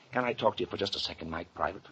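low, hushed aside; confidential aside. Acted. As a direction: ask quietly for a private word. Asking for a private word.

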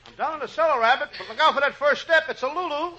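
high-pitched comic wail; high, hurt little holler; calling from below. Acted. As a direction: call up plaintively after a tumble. Calls up from bottom of cellar.

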